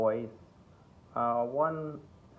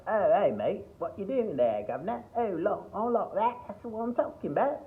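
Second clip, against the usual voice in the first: hammed-up Cockney, much higher and louder; exaggerated Cockney caricature, pitched way up; mock Cockney; 12 semitones higher, 5 dB louder than usual. Shown as a demonstration. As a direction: do an over-the-top Cockney geezer. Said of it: "using Cockney, right? So they'll be like".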